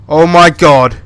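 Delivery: sudden frightened yell; startled shout. Real, not acted. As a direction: jump out of your skin and shout it. Jump scare in horror game.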